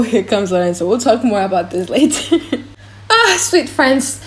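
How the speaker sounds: ordinary talk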